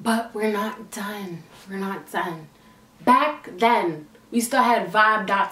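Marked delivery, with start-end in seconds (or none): 0.0-2.5 slow, much softer, almost under the breath; dramatic hush. Real, not acted. Vlogger's dramatic aside about the story.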